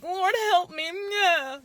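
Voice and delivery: high, breathy-free damsel cry for help; damsel in distress. Retold or imitated. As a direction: play the helpless damsel begging the Lord. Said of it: "now I'm a damsel in distress".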